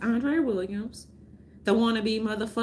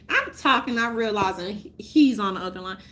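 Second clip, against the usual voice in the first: ordinary talk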